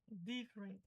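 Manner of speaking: "different" stressed on the first syllable. Shown as a demonstration. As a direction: say "different" as DIF-rent. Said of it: "A entonação tá no di". ("different" com entonação no di)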